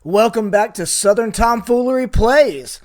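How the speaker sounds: loud, upbeat welcome to listeners; excited. Real, not acted. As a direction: greet the fans with real excitement, loud and punchy. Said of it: "why I sound so excited".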